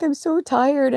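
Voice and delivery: high, whiny mock sob; retold crying exhaustion. Worn-out owner to her cat, crying. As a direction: imitate crying from tiredness. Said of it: "I was just, like, crying".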